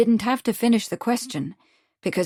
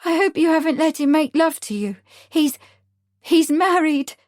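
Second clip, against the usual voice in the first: high, louder pleading warning; distressed outburst; 9 semitones higher, 6 dB louder than usual. A narrator's reading. Nellie warning Lottie the man is married, higher and louder. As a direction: urge her, near tears. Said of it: "Nellie burst out".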